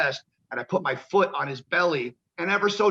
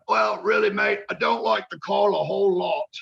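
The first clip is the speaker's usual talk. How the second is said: gruff British veteran; 4 semitones higher than usual. Retold or imitated. Veteran warns rookie he calls the match, mock British voice. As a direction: warn him off like a hard old pro. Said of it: "And he goes".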